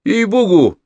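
high pleading with forced laughter; fake laughing. A narrator's reading. Gavrila fakes a laugh to the young master, pleading. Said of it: "ненатурально смеясь".

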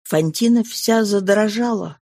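ordinary delivery

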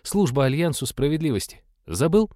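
put-on a righteous young paladin reminding; earnest paladin. A narrator's reading. Paladin reminds of joint quest.

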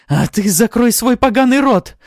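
loud, high-pitched furious yell; angry bark. A narrator's reading. Succubus silencing her mocker, barking. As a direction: bark the order at full anger. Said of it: "рявкнула Артара".

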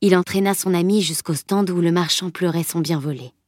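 usual speaking voice

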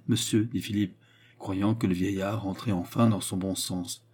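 ordinary delivery

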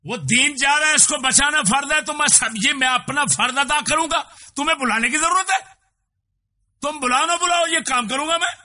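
high, loud preacher's outcry; thundering sermon voice; fiery preaching. Acted. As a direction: preach it at full force. Loud impassioned preaching burst.

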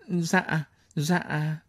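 soft frightened yes; timid reply. Acted. Frightened wife answering the angry commander, soft and timid. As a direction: answer meekly, barely audible. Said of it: "run lẩy bẩy".

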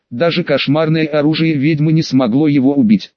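ordinary delivery, nothing marked

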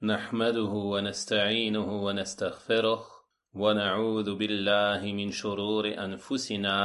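low, even formal recitation; Arabic opening invocation. Acted. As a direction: recite the opening praise in a measured monotone. Opening Arabic sermon formula. (récitation liturgique, basse et posée)

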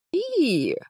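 high disgusted squeal; a sharp squeal of disgust. A narrator's reading. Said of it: "взвизгнула та".

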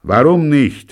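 loud, high gruff question; brusque loud demand. A narrator's reading. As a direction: snap the question. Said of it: "fragt er und stößt sie barsch".

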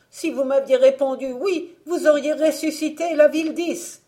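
high, loud outcry of reproach; ringing, high-pitched denunciation; crying out. A narrator's reading. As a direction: cry out as the cursing old woman. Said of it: "s'écria alors la vieille".

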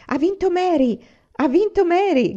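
high, loud cheering shout; excited shout. A narrator's reading. Little sister cheers Mary's win, high excited shout. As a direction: cry it out, clapping with joy. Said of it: "gridò Carrie battendo le mani".

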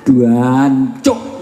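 stretched "Duancuk" with a drawn-out first syllable; East Javanese curse. Retold or imitated. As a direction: swear it East Java style, dragging the "Duan". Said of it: "Apalagi Jawa Timur". (umpatan Jawa Timur "Duancuk" dengan suku kata pertama dipanjangkan)

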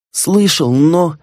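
high, nervous servant voice; a timid servant falters; hesitant servant. A narrator's reading. Servant hesitates before the master.

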